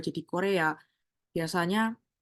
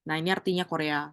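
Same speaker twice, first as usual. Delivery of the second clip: usual speaking voice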